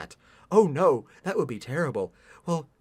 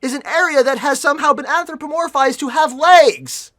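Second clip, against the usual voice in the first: mocking; 7 semitones higher, 6 dB louder than usual. Real, not acted. Mocking a wrong word choice.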